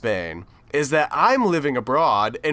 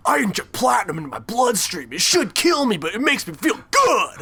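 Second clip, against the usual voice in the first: manic movie character; 6 semitones higher than usual. Retold or imitated. Acting out a Nicolas Cage character.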